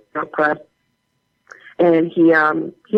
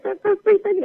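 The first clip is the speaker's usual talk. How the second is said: high-pitched cooing imitation; mock gushing sweetness. Co-star touching her shoulder, as she imitates him, gushing sweetly. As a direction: coo it up high, like a fond compliment. Retelling co-star's sweet reply.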